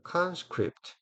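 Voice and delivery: conscript with CON said high and strong, script falling away; stress on first syllable. Shown as a demonstration. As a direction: lean on the front of conscript. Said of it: "강세를 넣어서".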